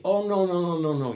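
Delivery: loud, high insistent no, no; emphatic protest. Retold or imitated. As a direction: wave away her objection forcefully. Villagers insisting she is wrong.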